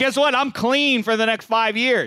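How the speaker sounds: high, loud and smug, rubbing it in; gloating. Real, not acted. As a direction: crow that you got away with it. Gloating at the league.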